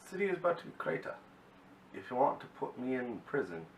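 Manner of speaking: fast, high, hammed-up Russian accent; mock Russian villain accent; bad Russian accent. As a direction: rattle it off in a god-awful Russian accent. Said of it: "that was my god-awful Russian accent".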